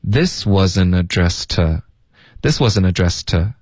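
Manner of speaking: to 弱读成 t; slow, with to reduced to a bare t; weak to. Shown as a demonstration. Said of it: "我们会弱读成t". (to弱读成t)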